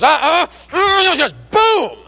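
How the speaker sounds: mock grunts of a woman stabbing a jar lid; grunting effort. Granny stabbing the jar, grunting with effort. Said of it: "'Cause she goes".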